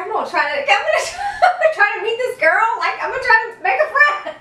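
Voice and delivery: high-pitched giggly inner voice; laughing through eager excitement. Retold or imitated. Retelling her own eager thoughts.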